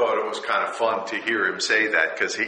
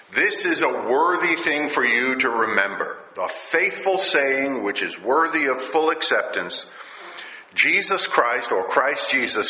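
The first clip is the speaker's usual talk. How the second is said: higher, louder preaching paraphrase; 5 semitones higher, 4 dB louder than usual. Acted. Pastor retelling the verse to his congregation, raised. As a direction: lift the verse for the hearers.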